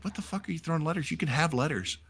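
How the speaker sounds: high, fast, hushed baffled sergeant; quiet mock disbelief. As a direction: rattle it off, puzzled. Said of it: "He's like".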